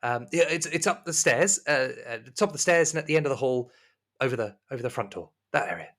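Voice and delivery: high, courteous hostess voice; high-pitched, attentive servant; polite serving woman. Acted. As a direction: invite the guest in, pitched well up. Plays Rosemary in the game.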